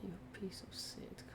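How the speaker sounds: whispered exasperated curse. Real, not acted. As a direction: hiss it at the car. Whispered insult to the car.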